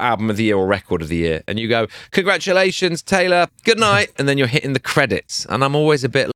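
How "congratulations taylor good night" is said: mock host sign-off. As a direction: play a cheesy awards host.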